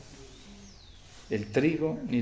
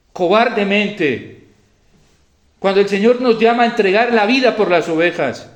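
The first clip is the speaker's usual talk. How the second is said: loud, high, emphatic denunciation; forceful preaching; 7 semitones higher, 9 dB louder than usual. Real, not acted. Preacher rebuking priests who hid in pandemic, forceful preaching. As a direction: hammer it home from the pulpit.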